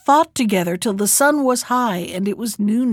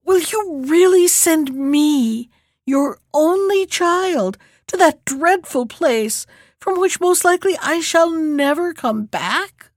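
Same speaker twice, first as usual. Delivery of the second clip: higher, slow tearful plea; sobbing; 6 semitones higher than usual. Acted. Princess begging her father not to send her, tearful. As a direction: plead through tears, slowly. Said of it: "she burst into tears and sobbed out".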